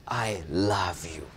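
whispered tenderly, no voice. Shown as a demonstration. Preacher whispering love to a brother. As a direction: breathe it softly like a loving secret. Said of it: "We can say".

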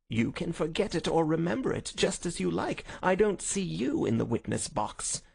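high, smug, lilting voice; dismissive visitor Smith. Acted. Visitor dismisses Holmes as a witness, smug. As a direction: brush him off carelessly.

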